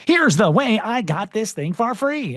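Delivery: light TikTok robot read. Pretend. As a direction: mimic the text-to-speech TikTok voice lightly. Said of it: "you like my TikTok voice?".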